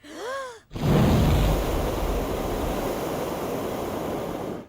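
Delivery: long, high breathy gasp; surprised gasp; amazed gasp. Acted. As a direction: gasp in wonder at the dragon's fire. After fire-breathing picture.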